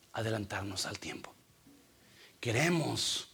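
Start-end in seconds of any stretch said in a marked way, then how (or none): 0.1-1.3 whisper. Acted. Preacher drops to a whisper.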